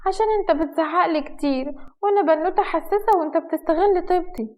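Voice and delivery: high-pitched, put-on girlish whine; coquettish sulking. Wife plays sensitive girl to husband, coquettish sulk.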